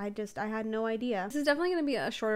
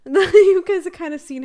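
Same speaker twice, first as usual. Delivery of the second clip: laughing; 8 semitones higher, 17 dB louder than usual. Real, not acted. Laughs at fumbled word.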